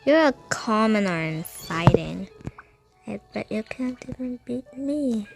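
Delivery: ordinary delivery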